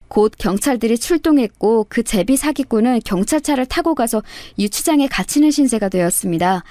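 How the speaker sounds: ordinary delivery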